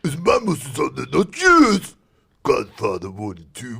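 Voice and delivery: high, loud macho actor voice; mock tough actor. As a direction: ham up a vain star. Imitating actor hogging creatine.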